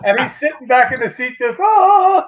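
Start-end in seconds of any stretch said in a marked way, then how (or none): 1.6-2.2 a higher, louder, self-satisfied "oh"; the prankster savoring his joke, smug and loud; smug mock "oh". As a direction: lean back and gloat with one long "oh". Friend leaning back, smug after prank.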